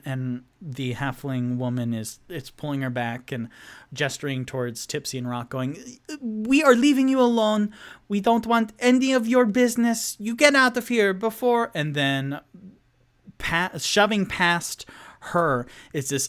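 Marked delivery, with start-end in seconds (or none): 6.2-11.7 high, louder, fast scared warning. Acted. Halfling woman warns Tipsy and Rock to get out. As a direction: warn them off in a hurry.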